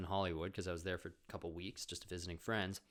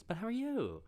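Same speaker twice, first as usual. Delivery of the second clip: high, sing-song caring question; high mock asker; 4 dB louder than usual. Friend pressing him kindly, a high mock asker. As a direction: lilt it up like a sweet friend. Imitating someone asking.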